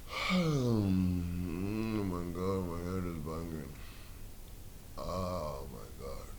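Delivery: very low, slow, faint morning voice; hungover groan. Acted. Man waking with his head banging, hungover. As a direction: groan it out through a pounding headache.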